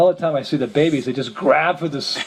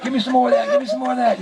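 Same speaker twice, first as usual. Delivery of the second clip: greedy priest; 9 semitones higher, faster than usual. Retold or imitated. Priest demanding more food.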